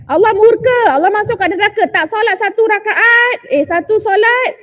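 high, insistent threats; acted hellfire scolding. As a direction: frighten a child with stern warnings. Voices elders' threats of hellfire.

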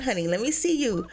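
usual speaking voice

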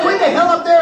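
high mock yell from the aisle; retold scream. The kid yelling up at the stage, mock yell. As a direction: holler it up at the stage, half-hearted. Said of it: "He's screaming from the aisle".

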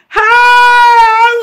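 loud, high drawn-out holler; calling out. Retold or imitated. Sister retells entering a motor, loud drawn-out call. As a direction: stretch it out in a long mock call.

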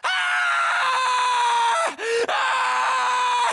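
sharp yell of pain; wounded soldier's yelp; pained cry. Acted. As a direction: cry out as if hit. Soldier hit in battle.

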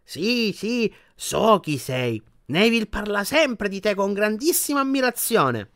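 a stately grandmother paying a compliment; formidable old witch. A narrator's reading. Grandmother voiced in story. (anziana strega solenne, voce acuta)

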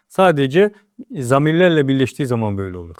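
usual speaking voice

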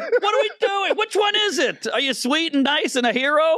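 can't believe what he sees; incredulous. Real, not acted. Ranting at hypocritical critics, incredulous.

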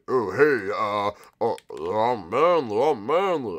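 lightly put-on manly voice, trying it out. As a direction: test out a mock manly voice. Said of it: "put on my manly voice".

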